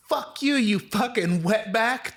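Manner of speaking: high, drawn-out mock outburst; woman hurling insults. Driver insults the storyteller, high mock-angry imitation. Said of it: "She was like".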